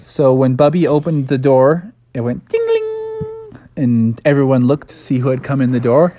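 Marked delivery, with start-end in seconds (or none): 2.5-3.6 high, softer ringing ding-ling; doorbell jingle. Retold or imitated. Storyteller as Bubby opens the door, high bell imitation. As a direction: imitate a little shop bell, high and drawn out.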